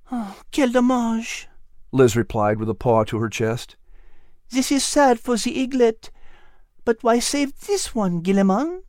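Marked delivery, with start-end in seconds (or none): 0.1-1.5 high, slow French sigh; a soft French lament; French cat, saddened. Acted. Liz sighs in French. 4.5-8.8 high, French-tinged voice, gently sad; a tender French lady cat grieving; French cat character. Acted. Liz asks.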